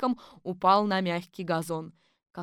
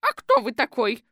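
higher, louder suspicious question; 5 semitones higher, 7 dB louder than usual. Acted. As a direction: demand to know who he is. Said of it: "подозрительно спросила она". (громко и подозрительно, голос выше)

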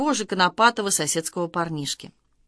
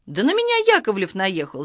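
usual speaking voice

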